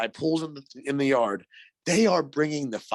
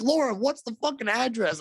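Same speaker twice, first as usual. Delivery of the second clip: high-pitched, frantic retold question; panicked demand; 10 semitones higher than usual. Caller asks Laura for the address, frantic and high. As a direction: act out going blank in a panic.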